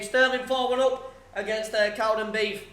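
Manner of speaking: results announcer read. Acted. Streamer calling out scores like a broadcaster. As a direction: read it like the Saturday results service. Reads football results aloud.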